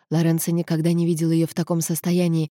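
ordinary delivery

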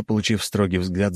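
usual speaking voice

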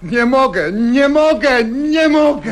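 cornered guest begging them to stop pouring; desperate refusal. Acted. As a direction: build from a loud plea into a near-shriek. Forced guest refusing drink.

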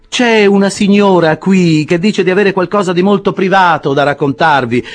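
a sly servant teasing his master; cheeky servant Sam. Acted. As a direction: Sam announces a lady to Pickwick, cheeky. Sam Weller announces a visitor.

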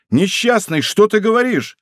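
high, appalled exclamation; shocked cry. A narrator's reading. D'Artagnan accused by the dying man, crying out shocked. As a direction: protest in shock, pitch shooting up. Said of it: "вскричал Д'Артаньян".

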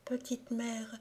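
put-on soft, high child's whisper. A narrator's reading. Dying boy whispering to his mother. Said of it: "murmurèrent".

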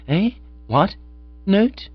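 sudden high startled yelps. A narrator's reading. Bertie caught off guard by Jeeves, startled high yelp. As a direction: jump at the word note.

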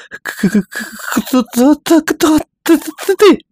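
high, breaking, stuttering with fear; terrified stutter. Acted. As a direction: stammer out the question in panic. Гиги в ужасе перед тварью. (испуганно, заикаясь)

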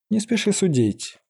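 high-pitched character voice; cautioning keeper. A narrator's reading. Old keeper cautions the mage.

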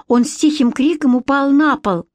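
usual speaking voice

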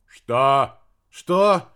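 loud shocked outburst; startled shout. Acted. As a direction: blurt it out in shock. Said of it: "не удержался Дорофеев".

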